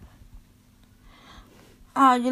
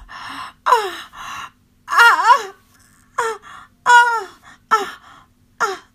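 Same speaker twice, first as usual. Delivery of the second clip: breathless cries climbing higher and louder; rising pleasure moans; 7 semitones higher, 7 dB louder, much slower than usual. Acted. Lover to partner, moaning louder and higher. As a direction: let the moans build into loud high cries.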